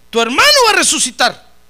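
loud and high, a commanding cry; booming proclamation. Acted. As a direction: proclaim it to the whole room. Preacher voices Jesus to Marta.

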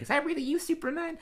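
breathless and awed; dreamy Marge. Retold or imitated. Marge in daydream.

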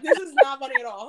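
loud, fast scolding through laughter; laughing imitation of a cross mom; stern mom. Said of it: "She's like".

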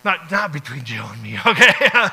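loud laughing denial. Real, not acted. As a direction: break into laughter as you deny it. Jokes with Jill, bursts out laughing.

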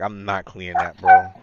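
defiant, pitch leaps on bro. Retold or imitated. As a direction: refuse it flatly with attitude. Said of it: "I was like".

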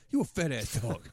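loud, high comic impression of someone insulting a dog; put-on voice, mock yelling; imitated yelling. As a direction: mimic a sitcom character heckling a dog. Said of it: "yelling at the dog".

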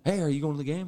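friendly high call to strangers; excited call-out. Retold or imitated. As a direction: call out brightly to a fellow fan. Greeting Chiefs fans on the trip.

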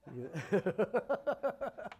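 a run of quick chuckles; laugh. Real, not acted. Preacher after declining what is offered, laughing.

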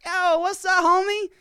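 high, hammed-up street slang greeting; mock street slang. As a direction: throw out a cocky homie hello. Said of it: "It's like".

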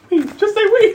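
high, laughing squeal; cracking up. Real, not acted. Player teasing friend asked for a French Canadian accent, cracking up. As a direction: squeeze it out through helpless laughter.

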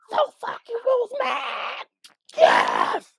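high cracked ecstatic yell; fan shout. Retold or imitated. Co-host reliving the crowd's roar, ecstatic fan shout. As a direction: scream it like a fan at the arena. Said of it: "as soon as you hear".

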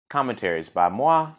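ordinary delivery

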